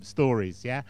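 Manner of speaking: usual speaking voice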